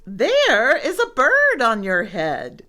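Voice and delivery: loud, high proclamation; piggy announcing it loud and high; excited announcement. A narrator's reading. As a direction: sing out the big news. Piggy announces bird to elephant.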